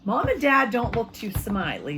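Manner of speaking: usual speaking voice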